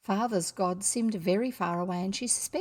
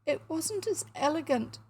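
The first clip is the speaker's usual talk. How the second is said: put-on high, halting, tearful child; acted sobbing; 6 semitones higher than usual. A narrator's reading. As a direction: falter through the words as if crying. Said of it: "sobbed Emily".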